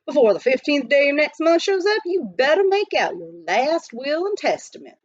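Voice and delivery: lightly voiced grumpy old miner; scolding miner. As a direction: warn him he's doomed. Billy scolds.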